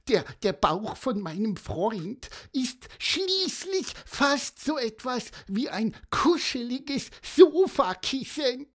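very high, grinning bird voice; cheeky little bird. Acted. Little bird teasing about his friend's belly, grinning squeak. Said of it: "grinste Pieper".

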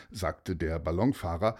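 ordinary delivery

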